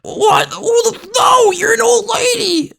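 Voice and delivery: put-on high, wailing, tearful shriek of a spoiled boy; crying child tantrum. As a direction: bawl it out like a kid whose toy is being taken. Binky voiced by the GM.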